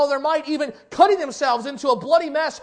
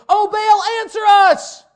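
loud pleading cry to Baal, higher; 9 semitones higher, 11 dB louder, slower than usual. Acted. Prophets crying out to Baal, loud and high. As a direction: call out to a silent god.

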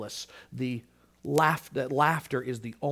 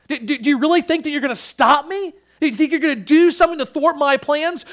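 higher and louder, incredulous scorn from above; mocking God voice; 10 semitones higher, 9 dB louder than usual. Preacher voices God scoffing at rebelling kings, mocking and loud. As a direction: voice God laughing at puny kings. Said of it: "and God looks down and says".

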